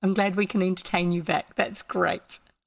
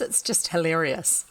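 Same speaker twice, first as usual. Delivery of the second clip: plain reading voice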